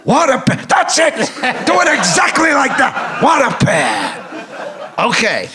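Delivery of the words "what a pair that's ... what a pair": high, loud delighted approval; approving director. Retold or imitated. Director finally approving the reading, high loud praise. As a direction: cheer him on, that's it. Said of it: "I go".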